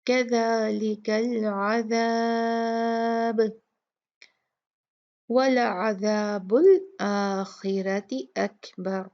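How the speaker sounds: slow chanted कज़ालिकल अज़ाब, ब bouncing; qalqala at the stop. Shown as a demonstration. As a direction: end on a bouncing ब. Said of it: "कलकला में पढ़ेंगे". (आख़िरी ब को हिलाकर पढ़ें)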